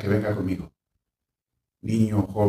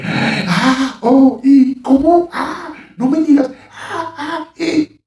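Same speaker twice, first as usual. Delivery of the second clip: much higher, louder panicked gasps; hysterical parents; 14 semitones higher, 8 dB louder, much slower than usual. Retold or imitated. Imitating parents overreacting to a child's abuse story, high panicked exclamations. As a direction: overreact in shock and alarm. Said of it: "empieza el papá y la mamá".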